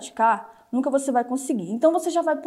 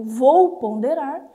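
slow deliberate pondering; 5 dB louder, much slower than usual. Shown as a demonstration. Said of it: "se passar pela peneira do".

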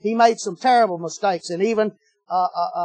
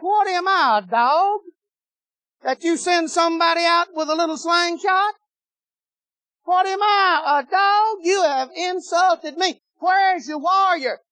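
taunting giant; 6 semitones higher than usual. Acted. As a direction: sneer down at the little shepherd boy. Goliath taunts David.

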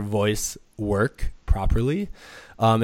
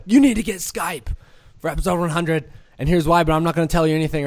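excited rush; 5 semitones higher, 4 dB louder, faster than usual. Retold or imitated. Excitedly pitching Brady over phone.

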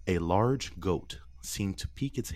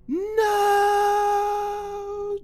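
long high crying scream; anguished yell; 10 dB louder than usual. Acted. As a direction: wail it out in terror. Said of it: "Gerald yelled".

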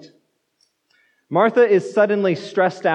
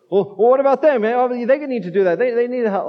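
put-on higher, protesting kid; complaining child; 5 semitones higher than usual. Son answering parent about clearing the table, mock protest. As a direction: imitate a child objecting to a chore. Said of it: "If you have kids".

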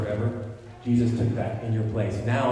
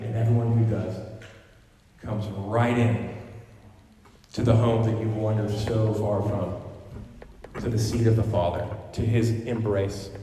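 usual speaking voice

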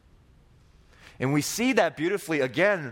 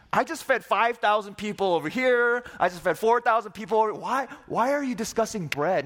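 higher, casual exasperated retort; exasperated Jesus; 6 semitones higher than usual. Acted. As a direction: roll your eyes at them, higher.

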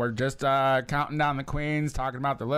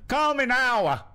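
mock Jamaican psychic hotline voice, slow and high; playful Miss Cleo imitation; Jamaican accent attempt; 10 semitones higher, 5 dB louder, slower than usual. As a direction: ham up a bad Jamaican accent. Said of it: "Can you do one?".

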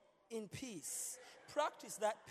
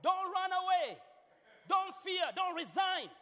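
urging; 8 semitones higher, 6 dB louder than usual. Real, not acted. Pastor urging the congregation.

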